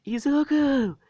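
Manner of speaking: high, loud squeal of the name; piercing, high-pitched outcry; shocked cry. Retold or imitated. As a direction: shriek it in astonishment at seeing them. Classmate cries out seeing him return.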